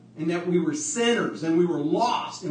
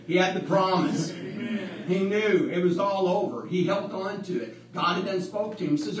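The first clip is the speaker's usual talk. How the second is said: ordinary talk, nothing marked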